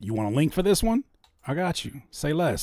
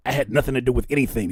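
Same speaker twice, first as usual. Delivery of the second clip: ordinary talk, nothing marked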